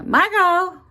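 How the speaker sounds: loud, high call, drawn out; loud sing-song call to Margot; calling the dog. Real, not acted. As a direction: call the dog from across the room. Calling the dog across the room.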